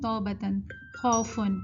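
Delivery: توبتن and خوفن with plain "aw" vowels; wrong reading. Shown as a demonstration. Teacher quoting common mistakes, vowels flattened. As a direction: say توبتن، خوفن without the glide. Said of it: "غلط پڑھتے ہیں".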